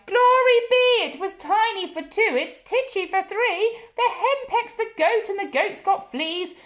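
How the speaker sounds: put-on high, loud old lady with a laugh; little old lady crying out, laughing at the chaos. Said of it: "The little old lady cried".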